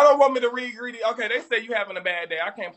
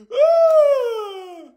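long, high, loud "oh"; one drawn-out whoop, sliding down at the end; high wailing hoot; hyped-up hoot; 11 semitones higher, 5 dB louder than usual. Real, not acted. Hooting after finishing the roast.

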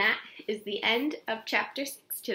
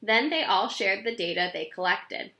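plain reading voice

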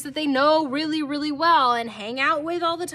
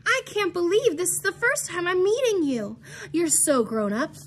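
high-pitched gushing welcome; delighted exclamation; 5 semitones higher than usual. Acted. Molly greets Marisol for the first time, exclaiming. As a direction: gush at a long-awaited meeting. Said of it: "she exclaimed".

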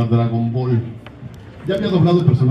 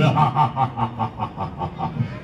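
Freezer's laugh; 6 dB quieter than usual. Acted. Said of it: "habla así".

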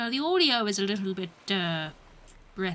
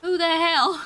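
loud, higher burst of disbelief; exasperated shout; 4 semitones higher, 7 dB louder, slower than usual. Real, not acted. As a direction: demand it loudly, baffled. Reacting to another scream.

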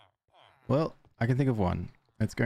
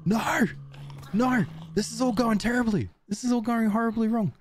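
panicked cry. Real, not acted. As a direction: cry out as it all collapses. Disaster unfolding in game.

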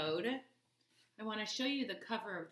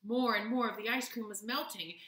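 ordinary delivery

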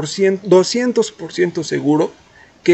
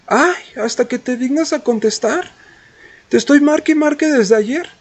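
high, mocking imitation of a clingy girl; mimicking the girl; 8 semitones higher than usual. Guy mimics the girl scolding him for not answering. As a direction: whine it back the way she nagged. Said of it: "Y me dice".

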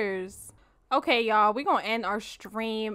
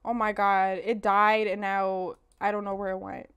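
ordinary talk, nothing marked

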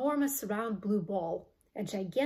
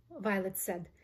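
ordinary delivery

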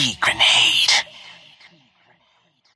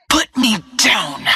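high yell, falling in pitch; shouted demand; 10 semitones higher than usual. Acted. Seized fighter ordering captor to release them, high shout. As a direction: cry out to be let go.

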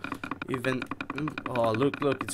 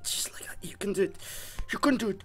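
squeaky encouragement; 9 semitones higher, faster than usual. Real, not acted. High-pitched cheering on bread.

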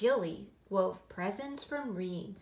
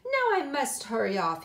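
louder, higher jaunty exclamation; exclaiming dapper cousin; 5 semitones higher, 6 dB louder than usual. A narrator's reading. Cousin hurrying off to polo, jaunty exclamation. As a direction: announce a hasty exit brightly. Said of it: "he exclaimed with a cough".

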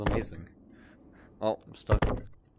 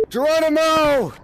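battle-cry yell; 7 semitones higher, 5 dB louder than usual. Real, not acted. Gamer leaping into danger, hollering a battle cry. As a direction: holler it like a daredevil jumping. Player leaps in the game.

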